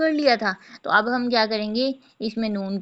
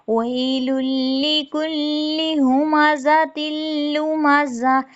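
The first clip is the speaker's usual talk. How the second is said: slow, higher melodic tajweed chant; Quranic recitation; 6 semitones higher, much slower than usual. Shown as a demonstration. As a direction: draw each word out like tilawat. Recites the full verse. (धीमी ऊँची तिलावत)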